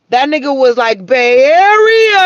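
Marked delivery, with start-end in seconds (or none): 1.0-2.2 high, loud, slow drawn-out "Bay Area"; stretched Bay Area call. Retold or imitated. Christ shouting out his home turf, high and drawn out. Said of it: "Like that".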